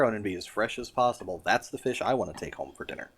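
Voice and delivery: ordinary talk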